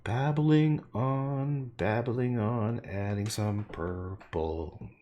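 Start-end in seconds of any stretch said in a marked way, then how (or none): 0.0-2.8 slow sing-song. Real, not acted.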